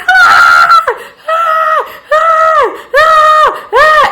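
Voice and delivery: repeated high mock screams; high-pitched screams in short bursts; re-enacted screaming. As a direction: act out panicked screams, loud and very high. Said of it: "But I'm just screaming. I'm like".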